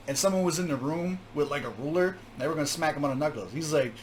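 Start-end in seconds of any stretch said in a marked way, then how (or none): none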